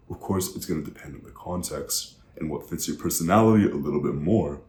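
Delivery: ordinary delivery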